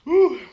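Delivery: high whooping yelp at the burn; whoop from the heat. Real, not acted. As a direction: let out a high, loud woo. Chili heat kicks in.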